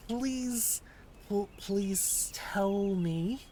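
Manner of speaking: put-on slow, deliberate American accent; American accent, drawn-out words. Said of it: "my American accent".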